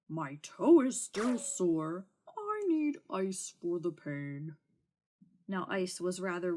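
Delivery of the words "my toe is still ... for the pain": slow, lightly voiced sore dragon; whiny dragon. Dragon to the knight, slow mild complaint. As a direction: drag it out like a sulking beast.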